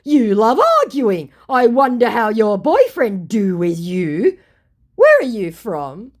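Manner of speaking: mock his deflection; hammed-up needling scammer. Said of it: "he goes".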